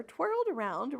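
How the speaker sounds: ordinary delivery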